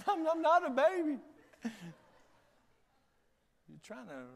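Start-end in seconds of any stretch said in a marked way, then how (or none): 0.1-1.2 put-on high, laughing protest of a big baby; mock crying. Husband protesting to his nurse wife over a splinter, mock crying. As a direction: play a hammed-up tearful denial. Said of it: "when I'm crying".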